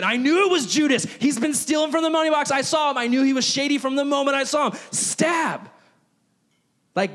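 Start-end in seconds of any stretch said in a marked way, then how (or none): 0.0-5.7 Peter's furious outburst. Retold or imitated. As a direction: explode like Peter reaching for his sword. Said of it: "Peter is grabbing his sword and saying".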